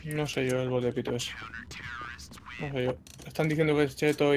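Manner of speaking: hushed, doubtful whisper. Real, not acted. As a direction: breathe it under your voice. Doubting a suspected cheater.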